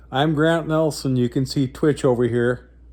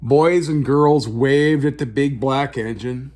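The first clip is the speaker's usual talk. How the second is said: story narration; 4 semitones higher than usual. A narrator's reading. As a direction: tell it like a bedtime picture book. Reads picture book aloud.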